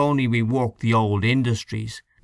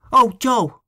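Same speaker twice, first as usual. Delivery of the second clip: higher and louder sudden outburst; 13 semitones higher, 6 dB louder than usual. A narrator's reading. O'Connor suddenly remembers Joe's poem, sudden higher louder call. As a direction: blurt it as the idea strikes. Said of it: "said Mister O'Connor suddenly".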